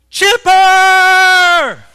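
very high, very loud drawn-out holler; mimicking a fan's huge climactic scream; imitated fan scream. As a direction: let out one long defiant fan yell. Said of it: "and he's like".

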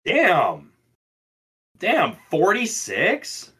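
drawn-out, rising high-pitched disbelief; real astonishment. Real, not acted. Reacting to friend's forty-six percent bottle, astonished. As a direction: stretch it out and swoop up on the number.

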